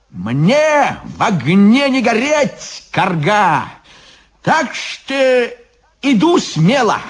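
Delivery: high, drawn-out bold boast; defiant bravado. Acted. Ivan faces the oven, defiant bravado. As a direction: declare it high and fearless. Иван храбрится перед печью.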